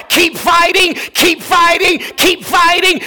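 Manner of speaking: rising preacher's plea; urgent exhortation. Acted. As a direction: drive it home each time.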